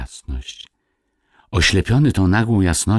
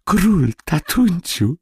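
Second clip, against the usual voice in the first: put-on high, breathless child's wonder; high-pitched awestruck little boy; spellbound; 13 semitones higher, slower than usual. A narrator's reading. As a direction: tell daddy in entranced amazement. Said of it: "szeptał Kubuś ... jakby urzeczony".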